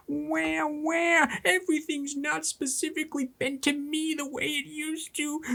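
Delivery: high, whiny crybaby voice, slowing on tyranny; high-pitched sniveling baby talk, mock. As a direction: whine it like a spoiled child mocking a tantrum. Mocking crybaby racists.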